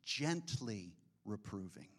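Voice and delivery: gently reproving said soft and low; soft emphasis. Acted. Preacher softly repeats the Greek phrase. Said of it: "literally is in the Greek".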